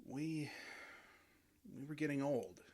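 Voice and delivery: ashamed murmur. Acted.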